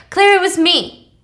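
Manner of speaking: high, loud confession; blurted confession. Retold or imitated. Acting him telling Claire the truth, blurting.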